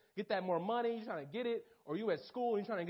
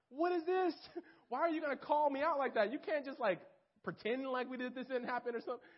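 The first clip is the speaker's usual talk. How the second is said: higher, laughing, indignant; half-laughing why-would-you-say-that; young man called out by his minister, protesting with a laugh; 6 semitones higher than usual. Retold or imitated.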